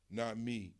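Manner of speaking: usual speaking voice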